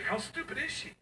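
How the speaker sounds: high-pitched incredulous question; comic incredulity, voice shooting up; mock disbelief. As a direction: let the pitch leap in mock bafflement. Mock disbelief at daughter's promise.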